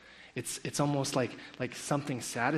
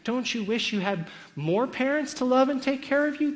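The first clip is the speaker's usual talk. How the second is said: imitating a teacher, pitched high; high, leading question to little children; mock-sweet coaxing in a raised voice; 11 semitones higher, 4 dB louder than usual. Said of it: "And she says".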